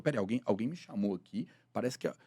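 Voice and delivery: low, soft thinking aloud; muted, puzzled self-talk; puzzled inner thought. Acted. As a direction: murmur it to yourself, wondering. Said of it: "ligar essa interrogação na hora".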